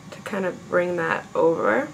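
ordinary delivery

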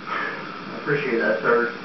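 low and soft, barely heard; meek reply. Acted. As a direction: shrink back timidly. Meek accountant answers the boss.

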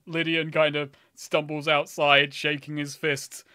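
ordinary delivery

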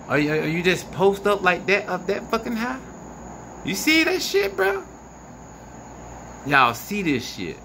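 usual speaking voice

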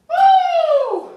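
loud high whoop; excited whoop. Real, not acted. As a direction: let out a big whoop. Mouth burning after last bite.

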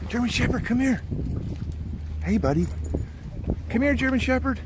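high, sing-out call to a dog; dog-greeting voice. Real, not acted. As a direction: coax the dog over in a high voice. Calling and cooing to dogs.

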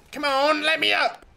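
high, loud urging; frustrated high-pitched demand; impatient yell. Real, not acted. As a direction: holler it, straining to get up. Impatient with the game.

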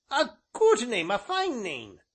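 high, loud rustic elder; high-pitched ancient, pleased; approving old man. A narrator's reading. Voices the ancient.

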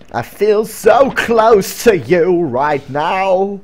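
slow, loud, high theatrical voice; hammed-up drawn-out confession; melodramatic declaration. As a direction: proclaim it like a soap-opera lover. Melodramatic line in put-on voice.

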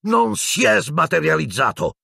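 high, strained angry outburst; furious roar. A narrator's reading. Professor contradicting the minister, roaring angrily. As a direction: roar it in rage without much volume. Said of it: "ruggì Piton".

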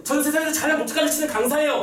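high-pitched ironic self-put-down; mock sarcastic announcement. Teacher addressing students as the worst instructor, mock sarcastic. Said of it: "라고 비꼬았는데".

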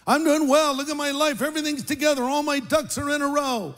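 mock cheerful. Mock cheerful self-portrait.

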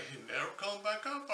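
ordinary talk, nothing marked

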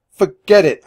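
loud, high, commanding refusal; forceful, decisive announcement; take-charge voice. A narrator's reading. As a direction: announce it like you are the boss. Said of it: "in my most take-charge voice".

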